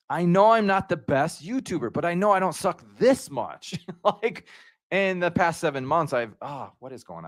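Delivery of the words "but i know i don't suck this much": ordinary delivery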